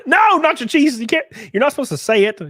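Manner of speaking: high, loud playful telling-off of chat; laughing scold. Real, not acted. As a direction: yell at chat half laughing.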